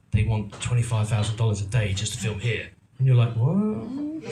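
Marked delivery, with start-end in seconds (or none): 3.4-4.2 mock shock. Retold shocked reaction to price.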